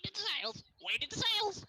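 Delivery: high squawking parrot call. Acted. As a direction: screech it like a parrot.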